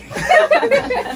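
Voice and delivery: loud, high-pitched cackling laugh; high burst of laughter. Real, not acted. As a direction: crack up at your own impression. Laughing at own impression.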